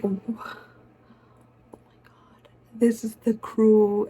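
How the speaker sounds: breathless excited whisper. Retold or imitated. Telling friends in a hushed whisper. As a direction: whisper it so they don't hear. Realizing the van is the crew.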